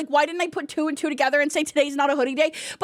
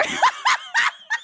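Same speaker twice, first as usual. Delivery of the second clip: high squealing laugh; helpless laughter. Real, not acted. Cracks up at the comment.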